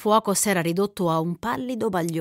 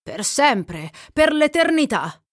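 building to a high, loud outburst; rapturous exclamation; 7 semitones higher, 5 dB louder than usual. A narrator's reading. Man vowing eternal love to the girl, exclaiming ardently. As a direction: swear it ardently, rising in pitch and volume. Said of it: "esclamò Ringwood".

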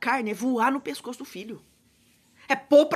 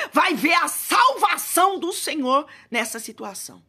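preaching at full force; 4 semitones higher, 5 dB louder than usual. Acted. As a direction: declare it loud over the crowd. Shouted prayer climax. (pregação gritada com toda força)

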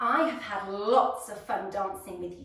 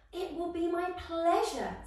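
bright, higher happy fairy voice; 7 semitones higher than usual. Acted. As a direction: answer gladly, eager to help. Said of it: "said Sugar Plum happily".

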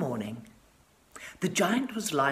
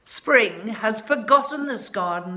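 snow and frost crying; 5 semitones higher, 4 dB louder than usual. A narrator's reading. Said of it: "they cried".

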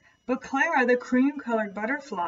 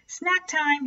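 high, gleeful crow's call; hungry exclamation; 8 semitones higher than usual. Acted. The crow spotting Katisha, a high gleeful shout. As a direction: spot the prey and gloat. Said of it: "he exclaimed".

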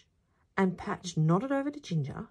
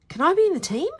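put-on high, loud boyish question; eager question; 12 semitones higher, 8 dB louder, much faster than usual. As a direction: ask out loud, pitched high. First take, before timid retake.

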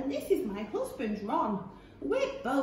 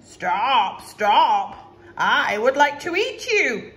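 a big hungry horse; 8 dB louder, slower than usual. Acted. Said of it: "neighed the horse".

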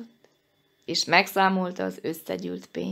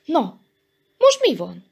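higher, louder curious question; impatiently inquisitive; 5 semitones higher, 6 dB louder than usual. A narrator's reading. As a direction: ask eagerly what's going on. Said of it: "kérdezte kíváncsian Köles".

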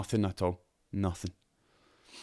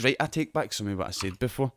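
ordinary talk, nothing marked

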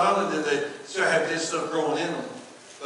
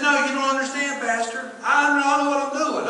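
higher and louder, pushing back on the pastor; insistent worker; 10 semitones higher, 6 dB louder than usual. Retold or imitated. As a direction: insist you know what you're doing.

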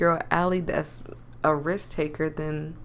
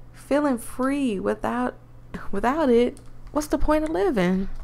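put-on Southern accent; 4 semitones higher than usual. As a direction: tease him in a Southern accent. Voices country girl Allie.

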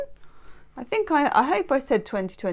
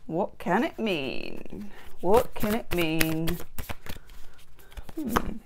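quiet; 4 semitones lower, 7 dB quieter, slower than usual. Real, not acted. Pondering a tarot card.

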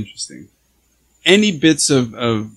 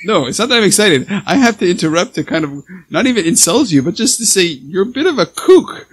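higher, louder, bursting to interrupt; real excitement; 6 semitones higher, 6 dB louder, faster than usual. Real, not acted. Host interrupts guest to call her a kook, excited. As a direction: cut in excitedly, pitched up and loud. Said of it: "I'm so excited".

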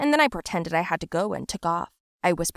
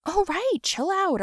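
placating; 11 semitones higher, slower than usual. Acted. Calming hysterical friend.

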